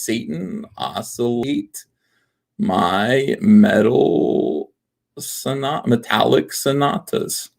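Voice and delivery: MY METAL stretched out extremely slowly; halting, very slow reading that breaks off at SONAT-; drawn out. Shown as a demonstration. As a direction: sound it out slowly, following the letters. Traces palindrome slowly letter by letter.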